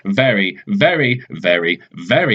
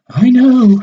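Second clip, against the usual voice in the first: high, louder know-it-all voice; smug and certain, pitched way up; Madam Know-It-All; 14 semitones higher, 8 dB louder than usual. Acted. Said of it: "she says".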